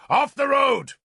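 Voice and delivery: high, loud barked order; shouting at drivers. A narrator's reading. Lord shouts at the ox drivers, full shout. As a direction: drive the men like cattle. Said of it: "I shouted at the ox drivers".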